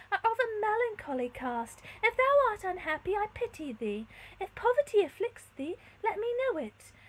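high, loud noble lady; elevated theatrical kindness; compassionate princess. A narrator's reading. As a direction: offer pity in stately phrases. Princess comforts the stranger.

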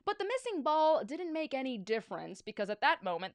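ordinary delivery

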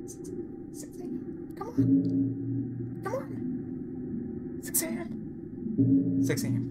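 breathless, whispered hoping; tense whisper. Real, not acted. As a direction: whisper it, willing the clock on.

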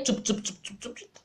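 soft imitated scrubbing noise fading to breath; scrubbing sound. Showing how she washes clothes in the river, imitated scrubbing.

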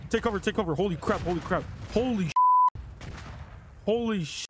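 high, urgent cries under fire; panicked scramble for cover; real alarm. Real, not acted. Player under attack calling to take cover, alarmed.

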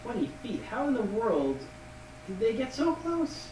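high-pitched, softly spoken disbelief; incredulous. Acted. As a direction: question it in rising, amazed disbelief. Player questions how close guards got.

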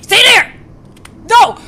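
loud, high-pitched panicked command; panicked shout. Real, not acted. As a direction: shout it in sudden alarm. Panicked commands in scary game.

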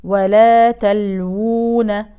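ولا تلوون very slow, waw held two counts; two-count madd. Shown as a demonstration. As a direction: hold the waw of تلوون briefly. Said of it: "تمد حركتين". (مد طبيعي)

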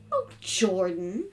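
usual speaking voice

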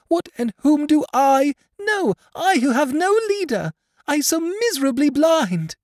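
put-on a blind girl crying out her hurt at her father; keen reproach. A narrator's reading. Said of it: "in a term of keen reproach".

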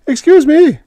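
very high and loud, cracking with urgency; a young aide's panicked shrill squeak; shrill, voice breaking. Acted. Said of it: "he shrilled, his voice breaking".

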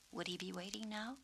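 plain reading voice

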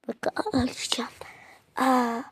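whispering ağlayacağım as if about to cry; breathy whisper of fake crying; whispered mock sob. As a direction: hush it out, pretending to be on the verge of tears.

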